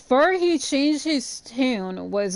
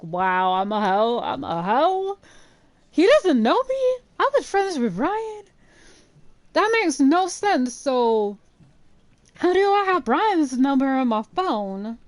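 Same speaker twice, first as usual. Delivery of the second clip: much higher, incredulous outburst; mock indignant disbelief; 5 semitones higher than usual. Retelling her reaction to being called a hoe, much higher and incredulous. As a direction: act out your own stunned offence.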